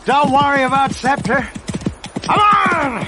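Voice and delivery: loud, high cry urging him on; rallying shout on horseback. Acted. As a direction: spur the horses on with a yell. Calling out, spurring horse on.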